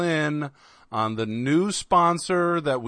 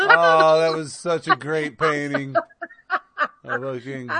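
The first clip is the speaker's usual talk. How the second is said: talking through laughter; laughing; 3 semitones higher than usual. Real, not acted. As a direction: laugh while you talk. Laughing at goat painting.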